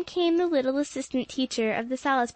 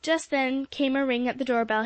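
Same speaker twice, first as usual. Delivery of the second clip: plain reading voice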